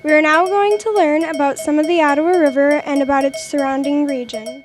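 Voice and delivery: loud, formal newscaster read; news anchor read. Acted. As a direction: announce the breaking news to viewers.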